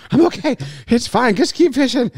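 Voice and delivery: high, strained gasping reassurance; winded fake calm. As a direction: pretend you're fine while gasping. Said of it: "I'm in pain and I'm winded".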